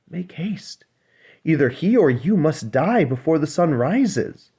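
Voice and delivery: high, pressing sisters pleading in a rush; urgent mermaid sisters. Acted. Sisters urging the little mermaid to save herself, high and pleading.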